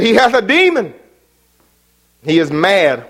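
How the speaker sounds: mocking crowd shout. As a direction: fling the charge like a hostile crowd. Preacher voices the accusing crowd.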